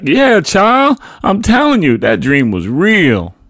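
high, loud call, slowing at the end; yelling back. A narrator's reading. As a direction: yell it across to the neighbour. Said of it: "my mother yelled back".

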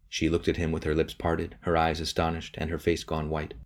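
usual speaking voice

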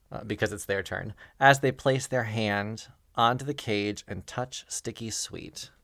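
ordinary talk

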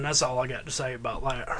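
low mock catchphrase voice; Forrest Gump. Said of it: "Why don't you do Forrest Gump".

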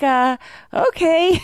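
reluctant okay. Retold or imitated. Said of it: "I was like".

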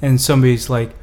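ordinary talk

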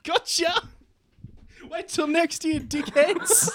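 high, loud mocking taunt through laughter; gleeful prankster banner. Prank banner taunting primary school kids, cackling high. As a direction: gloat like a cheeky sign yelling at kids.

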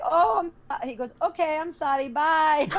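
a hint of Indian accent, flustered. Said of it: "He's like".

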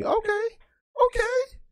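very high, put-on squeaky reaction; mock high voice. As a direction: jump way up into a pretend falsetto. Reacts to a vegetarian's approving comment.

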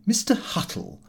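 ordinary delivery

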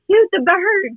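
high-pitched urgent mock yell; retold yell. Hunting buddy urging the nervous shooter to fire, retold yell. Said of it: "R P yells at me".

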